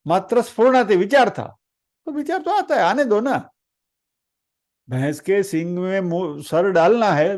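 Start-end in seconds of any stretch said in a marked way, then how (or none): 2.0-3.5 very high, lilting, carefree tone; playful high-pitched shrug; light dismissal. Acted. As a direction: wave it off airily. Waving off a passing thought.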